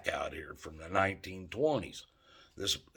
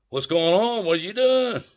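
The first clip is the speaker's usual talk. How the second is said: cop questioning; 10 semitones higher, 6 dB louder than usual. Retold or imitated. Cop questioning him on trail.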